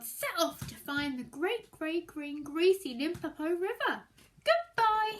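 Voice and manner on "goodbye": cheerful goodbye. Acted. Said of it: "he said".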